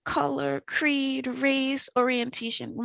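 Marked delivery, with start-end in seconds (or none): none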